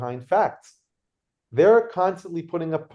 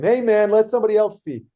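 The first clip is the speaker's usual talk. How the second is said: louder, higher mock crowd shout; audience yelling; 5 semitones higher, 5 dB louder than usual. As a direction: call out to silence the heckler. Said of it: "started yelling at him".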